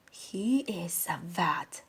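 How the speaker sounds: soft whispered aside. Real, not acted. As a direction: let them in on a secret. Aside to audience, secretive.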